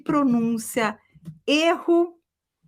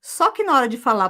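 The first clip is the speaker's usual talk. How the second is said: normal talking voice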